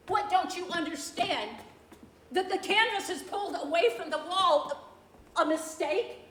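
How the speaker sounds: can't believe what she's seeing; stunned, climbing alarm; shocked disbelief. Acted. Discovering the ruined canvas.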